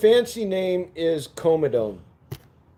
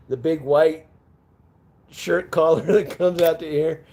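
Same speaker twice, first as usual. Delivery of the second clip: normal talking voice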